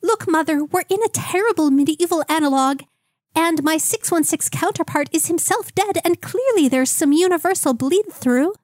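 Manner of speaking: Doug the Peasant voice. Acted. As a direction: stay in the squeaky lovesick boy voice. Keeps doing high Doug voice.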